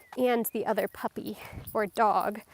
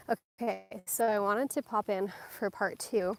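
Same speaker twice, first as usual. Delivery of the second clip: ordinary talk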